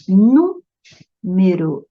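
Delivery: "Número" com o NÚ forte e esticado; sílaba tônica destacada. Shown as a demonstration. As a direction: alongue o NÚ de "Número" antes de soltar o resto. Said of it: "é outra proparoxítona". ("Número" separado depois da primeira sílaba)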